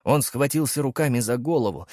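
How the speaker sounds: usual speaking voice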